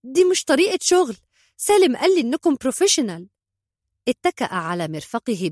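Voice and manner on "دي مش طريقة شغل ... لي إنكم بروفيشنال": high, loud indignant complaint; quick, raised-pitch outraged protest; shouted protest. A narrator's reading. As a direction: shout back at the man who wronged you. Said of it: "صاحت به عائشة".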